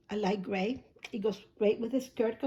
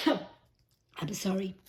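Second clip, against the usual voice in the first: losing her voice; much slower than usual. Real, not acted. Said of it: "I'm losing my voice".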